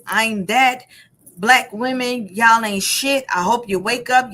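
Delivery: usual speaking voice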